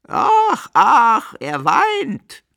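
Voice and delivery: very high, loud raven shriek; cawing ravens. A narrator's reading. Ravens cry to one another about the weeping man, shrieking. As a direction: screech it out like a raven. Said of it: "schrien die Raben einander zu".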